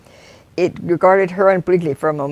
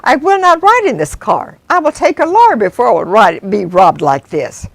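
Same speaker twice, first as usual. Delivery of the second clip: high, louder outraged refusal; outraged protest; 4 semitones higher, 5 dB louder than usual. Acted. Wife refusing to ride on, loud outrage. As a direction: stand your ground, furious.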